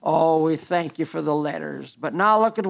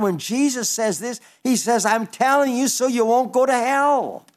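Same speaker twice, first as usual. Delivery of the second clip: raised, urgent pulpit voice; fervent preaching; 4 semitones higher than usual. Acted. Preacher pleads with listeners about hell, urgent and raised. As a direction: press the warning home from the pulpit.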